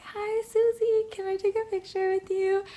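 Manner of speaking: high, soft, timid fan voice; shy. Retold or imitated. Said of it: "made me feel so shy".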